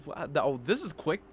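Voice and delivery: ordinary talk, nothing marked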